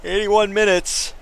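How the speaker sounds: slow, yawning words. Real, not acted. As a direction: say it through a yawn. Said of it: "I keep yawning".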